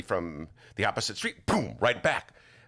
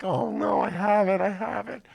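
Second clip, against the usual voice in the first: much higher, louder hammed-up alarm; mock panic; 14 semitones higher, 6 dB louder than usual. Freaking out on the walk home, in a high hammed-up panic. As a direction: fake a frantic hypochondriac. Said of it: "I'm like".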